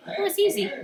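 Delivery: put-on high-pitched, loud little-kid voice; breezy child's boast, pitched high; little brother. A narrator's reading. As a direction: toss it off like a proud small boy.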